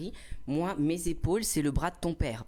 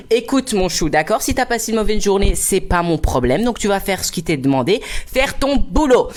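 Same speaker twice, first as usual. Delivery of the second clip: sassy, higher-pitched condescending retort; audacious; 4 semitones higher, 14 dB louder than usual. Retold or imitated. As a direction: lecture him with cheek, drawing out the end. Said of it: "avec toute mon audace".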